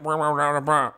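mock drive-thru speaker garble; mumbled nonsense through a bad speaker; garbled intercom mumble. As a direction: imitate an unintelligible intercom with wordless noise. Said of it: "they mumbled something into the intercom".

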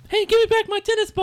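put-on very high, quick little-kid voice; small child protesting. Child Dell demands her tennis ball back, protesting in a very high kid voice.

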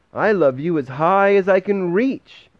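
a small hare reaching up proudly; little hare's voice. A narrator's reading. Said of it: "said little Nut Brown Hair".

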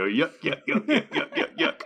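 high, laughing yuck yuck chant; laughing mock crowd. Partygoers mocking the French toast, laughing yuck chant. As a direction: cackle the yucks like a silly crowd. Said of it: "And then everyone go".

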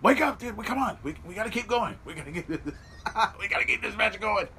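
high-pitched voicing of a pleading wrestler; hammed-up urging, breaking into a laugh; urgent urging. Wrestler rousing his dazed opponent, high urgent plea. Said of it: "He's like".

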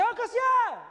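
high shouted call across the wall; calling a neighbour over the wall, high shout. Retold or imitated. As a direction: yell his name to the neighbour. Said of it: "gritei com você".

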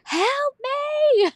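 high, loud drawn-out mock wail; imitated child's scream. Imitating hurt son screaming for help, mock scream. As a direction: wail it out like a panicking kid. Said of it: "he's screaming and screaming and screaming".

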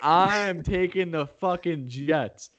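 high, slow, drawn-out declaration; emphatic insistence. Real, not acted. Host insists on the Jets over co-host's mockery, emphatic and defiant. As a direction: stretch it out, stubbornly hyped.

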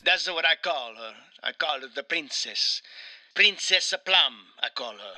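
wronged Italian husband, hammed up; Mario with Italian accent. Mario confides his brother's betrayal, in a hammed-up Italian accent. Plays Mario being interviewed.

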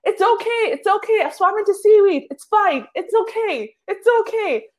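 high, squeaky it's okay; shaky mock calm after swimming into seaweed; high-pitched reassurance. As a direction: reassure the others while still rattled. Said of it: "And I'm turning around saying".

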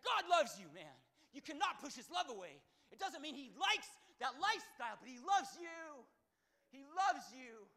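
loud, high, forceful declaration; exaggerated intense shout. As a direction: belt it out with full conviction. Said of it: "I didn't say it that intense".

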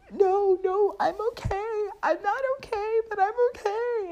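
very high crying whine, imitated; hammed-up weepy insistence; tearful protest. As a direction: wail through mock tears. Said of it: "and I start crying".

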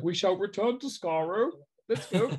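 stiff robot voice. Retold or imitated. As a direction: play the robot. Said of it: "he pretends to be the, the robot".

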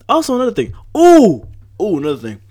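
light up as the idea hits you; excited. Real, not acted. Remembering another thing to tell listeners, excited.

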